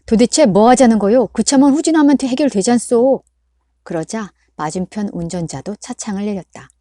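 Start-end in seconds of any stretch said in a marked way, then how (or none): 0.1-3.2 loud, higher angry yell; shouting driver. A narrator's reading. Driver shouting at the blocking car, loud and angry. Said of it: "소리를 질렀다".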